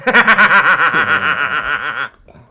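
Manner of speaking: big burst of laughter; loud laugh. Real, not acted. Loud laugh after joke.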